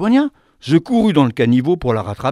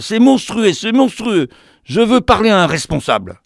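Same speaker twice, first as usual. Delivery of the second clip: plus aigu et fort, scandalisé; la dame outrée exige un responsable; outraged shouting; 7 semitones higher, 5 dB louder than usual. Acted. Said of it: "criait-elle".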